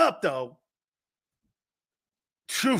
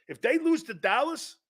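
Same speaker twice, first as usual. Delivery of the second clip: ordinary talk, nothing marked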